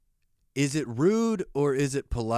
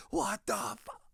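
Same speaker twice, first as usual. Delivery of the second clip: high, whispered imitation of an angry man; mock furious; 6 dB quieter than usual. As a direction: mimic his fury in a strangled, hushed squeal. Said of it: "He was so pissed".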